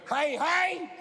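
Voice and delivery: put-on high, slow sing-song kids' entertainer; kids' party host. Comic greeting a birthday man like a kid at a party. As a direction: greet him like a children's party clown. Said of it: "treat it like a kid's birthday party".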